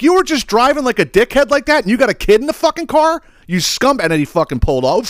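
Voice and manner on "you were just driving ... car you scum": high, loud disgusted rebuke; retold furious rebuke. Scolding the driver for the crying toddler, high loud disgusted rebuke. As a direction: scold him for endangering his kid. Berating driver with kid in car.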